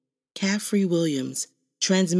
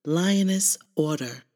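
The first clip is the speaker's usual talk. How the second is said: usual speaking voice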